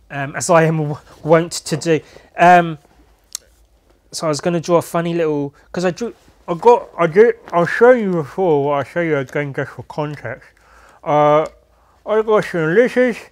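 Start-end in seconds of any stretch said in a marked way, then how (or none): 5.7-13.2 talking around a pen; words muffled by pen in mouth. Real, not acted. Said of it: "I'm holding the pen in my mouth".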